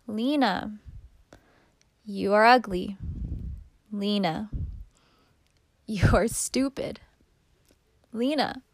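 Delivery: put-on halting, pained address to a child; as if forced to hurt a beloved child; speaking to daughter. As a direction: say it reluctantly, pausing after each name. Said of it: "Read these off as if you are speaking to Lena".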